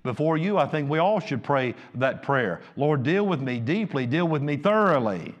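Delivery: high, loud pulpit preaching; fiery sermon delivery; full-force preaching. Acted.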